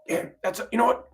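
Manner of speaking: usual speaking voice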